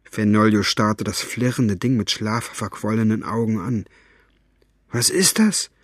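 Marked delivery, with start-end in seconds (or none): 4.9-5.7 higher, sleepy hoarse question. A narrator's reading. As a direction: ask it hoarsely, just woken. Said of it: "fragte er mit heiserer Stimme".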